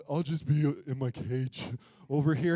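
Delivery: very low, sheepish dog voice; deep, droopy doggy apology; insecure talking dog. Retold or imitated. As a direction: apologise awkwardly as a dejected dog. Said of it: "he's awkward and insecure".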